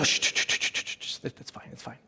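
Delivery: whispered hushing, dropping to near silence. Retold or imitated. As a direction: shush them in a hurried whisper. Relative hushing again.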